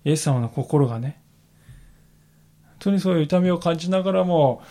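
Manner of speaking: ordinary talk, nothing marked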